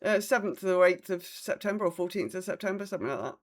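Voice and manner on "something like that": normal talking voice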